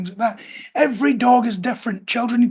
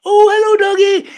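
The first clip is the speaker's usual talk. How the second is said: high, loud gushing hello to a dog; overexcited greeting; 9 semitones higher, 8 dB louder than usual. Shown as a demonstration. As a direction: squeal it like an overeager child reaching for a dog. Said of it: "and reach out all excited".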